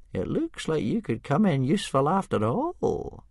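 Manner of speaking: put-on high old man's voice, musing; murmuring to himself. Old Wrinkly reflects on Hiccup's usefulness, murmuring in a high voice. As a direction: muse aloud as an old man. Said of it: "Old Wrinkly murmured to himself".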